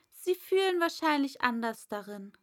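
put-on an eager young girl; Alice. Alice voiced. (schnelles, hohes Mädchen)

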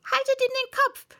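high, loud exclamations; clamour of voices. Acted. Crowd fussing over fallen Wabbel, high urgent shouts. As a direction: call out urgent instructions, one after another. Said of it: "dann wieder verschworene Stimmen".

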